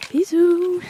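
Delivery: high playful sign-off; cutesy high bisous. Acted. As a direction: chirp it high and cute. Sign-off kiss.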